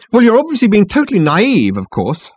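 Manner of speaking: high, loud condescension; strident girl. A narrator's reading. Marketing girl scolds Ford, stridently. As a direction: talk down to him stridently.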